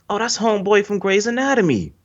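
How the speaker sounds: high-pitched dawning realization; retold sudden recognition. Viewer finally placing a familiar actor, surprised. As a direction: pitch it way up as you place the face.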